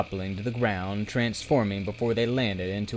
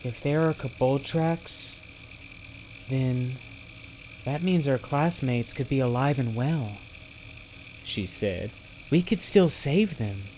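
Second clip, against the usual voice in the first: higher-pitched, lightly voiced hopeful heroine; hopeful young heroine; 4 semitones higher than usual. A narrator's reading. Zelly tells her friend their classmates could be saved, hopeful. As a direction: voice a touch of rising hope. Hopeful character speaking in a story.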